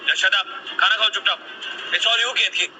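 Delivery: high-pitched, heated scolding; angry berating. Acted. Husband blames wife for child answering back, angry. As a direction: lash out in blame without yelling.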